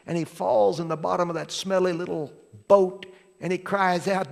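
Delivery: preaching. Acted. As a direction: drive the story forward with fervour. Preacher building to full force.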